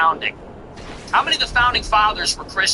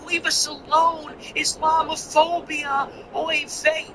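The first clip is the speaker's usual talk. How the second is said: higher, softer mock lament; mock victim whine; 7 semitones higher, 3 dB quieter, slower than usual. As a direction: whimper it as a put-on victim. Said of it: "And they go".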